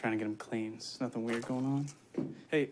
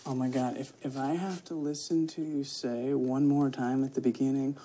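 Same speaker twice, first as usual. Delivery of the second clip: ordinary talk, nothing marked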